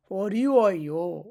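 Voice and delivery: অরিওয় stretched and loud, "o-riii-wo-yo"; teacher modelling অরিওয় slowly; slow sounding-out. Shown as a demonstration. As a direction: draw out each syllable of অরিওয়. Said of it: "এখানে উও হবে".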